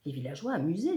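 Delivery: ordinary delivery, nothing marked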